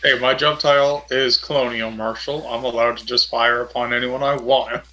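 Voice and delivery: ordinary delivery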